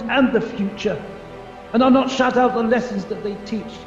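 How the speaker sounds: urgent, impassioned begging; frantic, forceful plea; desperate pleading. Acted. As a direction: beg for your life with rising insistence. Pleads with the spirit for mercy.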